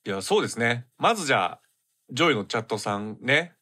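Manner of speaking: stuffy nasal voice from a cold. Real, not acted. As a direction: talk through a blocked nose. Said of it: "ちょっと鼻声でね".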